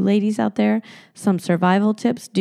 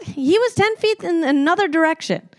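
indignant rant; 7 semitones higher than usual. Real, not acted. Ranting about her lie.

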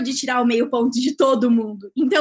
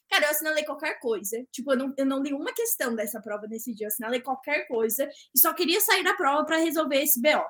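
usual speaking voice

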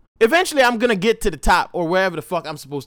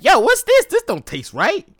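yelping, startled by the taste; shocked first bite; surprised disgust; 11 semitones higher, 5 dB louder than usual. Retold or imitated. Reacting to strange mayo taste.

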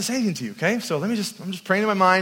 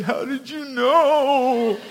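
slow tearful question; 5 semitones higher, much slower than usual. Retold or imitated. Sobbing man's question retold.

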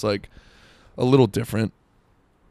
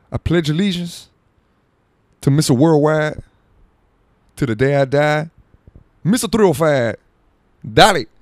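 high, loud hype-man rapper pledge; loud Miami rap-star boast; 6 semitones higher, 6 dB louder than usual. Pretend. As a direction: chant it like a pumped-up party rapper. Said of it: "Just me doing [name]".

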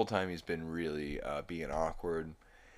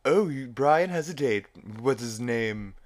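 Stewie impression; 6 semitones higher, 8 dB louder than usual. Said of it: "Stewie says".

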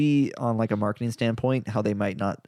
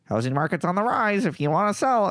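higher, pushy ad voice; mock sales pitch; 9 semitones higher than usual. Mocking a generic realtor message, pushy ad voice. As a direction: parody a canned realtor spam pitch.

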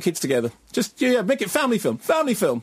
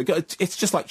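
normal talking voice

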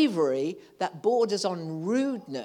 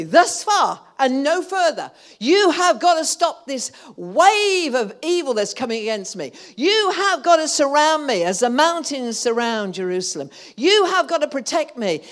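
loud and higher, declaring against the enemy; commanding prayer; 7 semitones higher, 10 dB louder than usual. Pretend. Preacher voicing prayer against the enemy, loud commanding. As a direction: stand your ground and command. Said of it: "You've got to say to the enemy".

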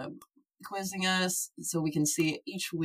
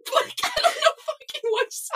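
laughing. Real, not acted. Quiz answer wrong again.

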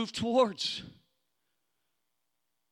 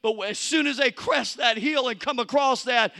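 normal talking voice

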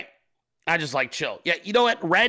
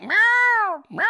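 high, louder squeaky imitation meow; mock cat meow; 4 dB louder than usual. Streamer imitating his cat meowing at him, high squeaky meow. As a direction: mimic the cat, pitched way up. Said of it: "Like, he was like".

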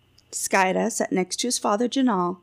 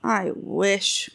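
ordinary delivery

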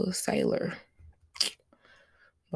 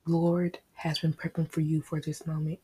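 normal talking voice

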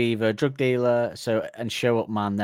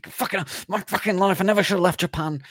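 high whiny despair; 9 semitones higher than usual. Retold or imitated. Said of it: "He's going".

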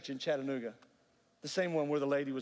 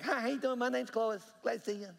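put-on Clois's North Georgia accent; 8 semitones higher than usual. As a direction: imitate a chirpy North Georgia greeting. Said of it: "North Georgia accents where he talked like this".

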